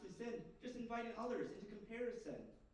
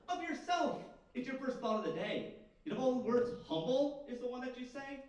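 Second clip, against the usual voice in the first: loud, high-pitched accusation; performed outburst of reproach; indignant rebuke; 6 semitones higher, 7 dB louder than usual. Acted. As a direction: call out the braggart, voice raised. Rebuking the humble braggart in verse.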